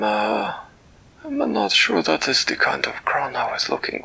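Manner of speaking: a delighted, fussy creature greeting a friend; Flibbertigibbet's voice. Acted. Storyteller voicing Flibbertigibbet greeting Winella, high and delighted.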